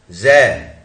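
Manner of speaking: जै loud and alone, as one syllable; isolated syllable. Shown as a demonstration. Teacher counting syllables for students, isolated demonstration. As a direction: sound out जै firmly by itself. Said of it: "हमारे पास syllable कितने हैं?".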